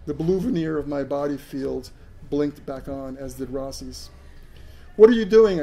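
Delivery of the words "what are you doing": full-throated furious scream. A narrator's reading. Max screams at the aliens stopping him, furious yell. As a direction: yell it at them with everything. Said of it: "I screamed".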